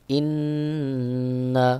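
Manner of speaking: ইন্না with a long held nasal নুন; nasalized ghunnah. Shown as a demonstration. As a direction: stretch the ন্ন of ইন্না slowly. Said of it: "গুন্নাহ কেমন হবে?". (গুন্নাহ সহ টেনে ইন্না)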